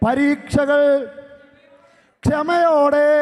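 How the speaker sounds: slow, emphatic sermon questions; preaching. Acted. Priest quizzing the congregation on the gospel, slow and emphatic. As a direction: draw the words out to the crowd.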